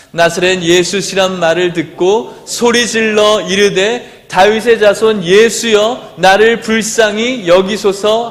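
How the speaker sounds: slow, measured reading of the verse; scripture read aloud together. Shown as a demonstration. Pastor leading the congregation in reading the verse, slow and steady. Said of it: "본문 말씀을 같이 읽어봅시다".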